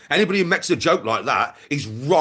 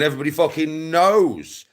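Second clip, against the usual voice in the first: insistent, with a long swelling "knows"; stress on knows; 2 semitones higher than usual. Real, not acted. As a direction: lean hard on "knows" and stretch it. Ranting, insisting it's common knowledge.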